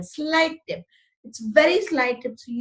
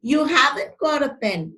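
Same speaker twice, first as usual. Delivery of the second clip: ordinary talk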